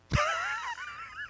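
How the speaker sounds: high squeal of laughter; shrieking laugh. Real, not acted. Reacting to joke.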